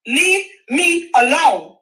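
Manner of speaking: slow, spaced leave me alone; slow final warning; slow firm repeat. Real, not acted. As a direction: drag it out, each word deliberate. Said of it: "repeated slowly after the shout".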